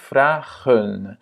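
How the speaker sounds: vragen drawn out, first A held long. Shown as a demonstration. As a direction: stretch the A in vragen. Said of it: "le premier A est long". (« vragen » avec un premier A long)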